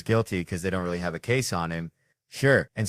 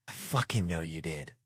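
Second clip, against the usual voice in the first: softer, dropped-down certainty; low-voiced accusation; 3 semitones lower, 7 dB quieter than usual. Detective repeating himself to the suspect, quiet and sure. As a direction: bring it down quietly.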